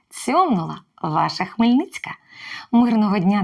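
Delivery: ordinary talk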